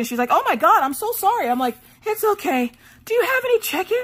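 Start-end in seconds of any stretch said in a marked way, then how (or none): 0.3-1.5 high-pitched, gushing apology. Retold or imitated. Lady apologizing to the runner, gushing apology. Voicing the lady's startled apology. 2.0-4.0 squeaky, mock-polite request; high-pitched polite plea. Runner asking the lady for chicken, high-pitched plea.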